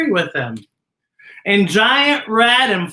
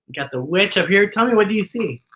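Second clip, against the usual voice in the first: usual speaking voice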